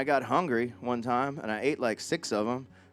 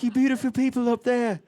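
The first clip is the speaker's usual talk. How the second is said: high-pitched pop star addressing the crowd; 9 semitones higher, 4 dB louder than usual. Retold or imitated. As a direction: imitate a star calling up to the balcony. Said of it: "He's like".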